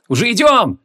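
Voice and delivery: high, eager shout in answer; playful battle shout; calling back. A narrator's reading. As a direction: answer the rallying cry, pitch up. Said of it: "откликнулся Шамшуддин".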